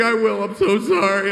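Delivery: high, loud, apologetic yelp; flustered apology. Retold or imitated. Caught out, apologizing loud and high. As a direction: blurt the apology in a panic.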